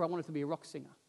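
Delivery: normal talking voice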